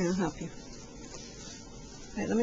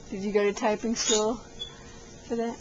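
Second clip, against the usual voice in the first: ordinary talk, nothing marked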